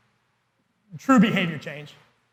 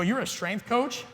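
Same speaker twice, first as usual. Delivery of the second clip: softer, slow, put-out question with a rise; a hint of a scornful stranger, softer and slow; indignant; 2 semitones higher, 8 dB quieter, slower than usual. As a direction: mildly mimic their indignant disbelief. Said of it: "in kind of an indignant tone".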